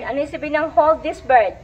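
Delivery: usual speaking voice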